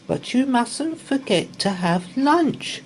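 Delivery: high, lilting mother voice; a fussing mum reminding her boys; mummy's voice. A narrator's reading. Mum reminding the boys about lunch, high and lilting. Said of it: "said their mummy".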